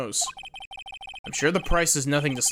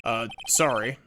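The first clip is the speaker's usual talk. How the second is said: usual speaking voice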